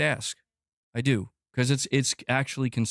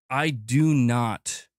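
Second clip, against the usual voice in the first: "I do not" drawn out very slowly, each word weighted; emphatic insistence; much slower than usual. Real, not acted. Streamer insisting he hates the fighting, "I do not" slow and emphatic. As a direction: lean slowly and hard on every word of "I do not".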